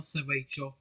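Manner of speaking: ordinary delivery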